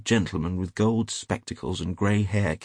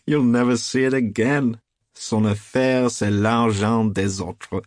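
loud, higher, laughing delivery; roaring with laughter; 4 semitones higher, 7 dB louder than usual. A narrator's reading. Trevor teasing his friend about the lost sovereign, laughing. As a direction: laugh the words out loudly. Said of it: "burst into a roar of laughter".

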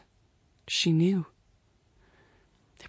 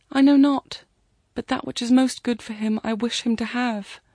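higher, earnest, louder at the start; praying girl, fervent; 5 semitones higher, 5 dB louder than usual. A narrator's reading. Girl asks a blessing for the man, fervent. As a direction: plead for him with feeling.

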